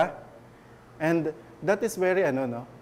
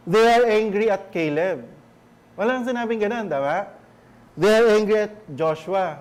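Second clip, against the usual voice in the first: preaching louder and higher about the people's anger; forceful preaching; 3 semitones higher, 4 dB louder than usual. Acted. Pastor rousing the church about grumbling against Moses, forceful preaching. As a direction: drive the point home from the pulpit.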